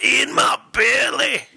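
high, drawn-out film-villain growl; greedy Scottish brute, hammed up; Fat Bastard. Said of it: "the, uh, fat bastard".

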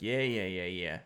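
a hint of a gruff New Jersey diner waitress; dismissive waitress. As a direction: wave them off like a busy diner waitress. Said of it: "the waitress was like a movie character".

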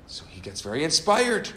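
ordinary talk, nothing marked

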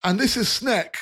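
high, apologetic chubby fellow; jolly rotund man. Acted. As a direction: soothe the startled kid cheerfully. NPC introduces himself.

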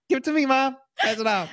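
put-on squeaky, excited kid voice; a little girl grabbing for it; high girl voice. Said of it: "She's like".

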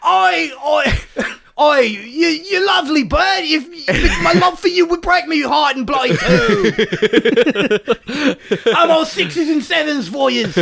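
put-on high, shouty comic British accent. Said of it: "that's what [name] sounds like".

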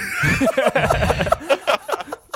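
high burst of laughter; cracking up at the joke; big laugh. Real, not acted. Joke about beer going bad.

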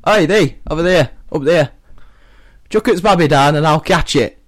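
lightly put-on Yorkshire shout up to a window; a touch of bold Yorkshire, calling upward; Yorkshire accent. Peter Cech calls up to the woman in a mild Yorkshire accent. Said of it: "let's pretend he's Yorkshire".

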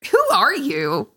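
high, loud startled outburst. Real, not acted. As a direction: jump at a strange voice. Friend's voice sounds alien.

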